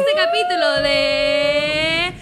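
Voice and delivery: drawn-out, rising build-up; show announcer. Real, not acted. Host opening her girls' night episode, announcing. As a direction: stretch it out toward the title reveal.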